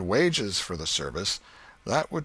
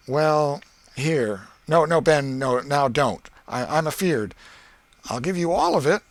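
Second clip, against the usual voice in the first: put-on lightly raised, louder boyish voice, quick at the end; boy Tom, sly; 6 semitones higher, 6 dB louder than usual. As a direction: play it cool and sly like a scheming kid.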